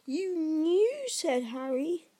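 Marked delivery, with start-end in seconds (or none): 0.1-1.0 put-on higher-pitched voice of a startled boy; raised, incredulous question; astonished boy. A narrator's reading. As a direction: take it in with disbelief, pitch lifted. Harry reacts in astonishment.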